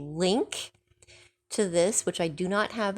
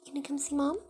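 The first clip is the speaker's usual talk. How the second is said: sweet coaxing; 9 semitones higher, much faster than usual. Real, not acted. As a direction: coax the bird sweetly. Coaxing the bird.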